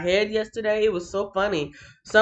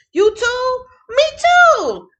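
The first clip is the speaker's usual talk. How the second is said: squeaky high voice; 10 dB louder, much slower than usual. Real, not acted.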